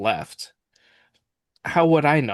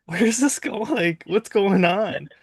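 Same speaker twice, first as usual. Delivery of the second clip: much higher, slow, lost and puzzled; bewildered; 10 semitones higher, slower than usual. Retold or imitated. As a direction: look up at the sky, totally confused. Confused golfer looking up.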